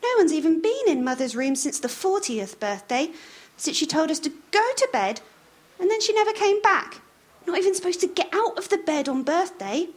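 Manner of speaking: put-on high, hushed child's voice; little girl. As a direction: tell it like a nervous girl. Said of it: "said Sally".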